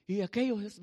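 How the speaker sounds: ordinary delivery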